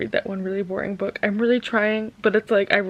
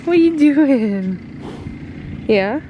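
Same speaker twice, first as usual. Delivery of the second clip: higher, slow cooing at the horses; pet talk; 5 semitones higher, much slower than usual. Real, not acted. Greeting her horses.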